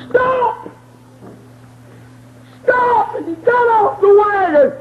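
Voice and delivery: high crying shout, slowing down; preaching climax. Acted. Preacher whooping the reunion to the church. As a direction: whoop it out as they run together.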